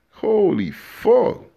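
high, slow, loud disbelief; shocked. Real, not acted. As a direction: let it rise in sheer disbelief. Shocked reaction in rant.

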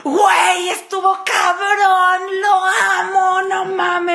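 high, loud, slow gush; passionate gushing. Retold or imitated. Describing past exes to her friend, gushing loudly. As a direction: gush over the ex like you're swept away. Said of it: "con otros exes era así de".

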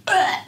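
a squealed high "ugh"; high disgusted ugh. Real, not acted. Recoiling from creating for algorithms, high ugh.

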